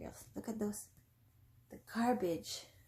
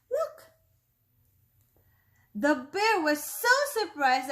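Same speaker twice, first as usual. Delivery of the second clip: storyteller acting the bear's shock; dramatic surprise narration; 7 semitones higher, 6 dB louder than usual. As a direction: gasp it out then race away.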